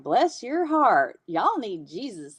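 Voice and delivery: slow, drawn-out Southern mock judgment; funny judging. Shown as a demonstration. Explaining the Southern slogan, funny judging tone. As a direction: stretch each word out, playfully judging. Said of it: "we're judging, but in a funny way".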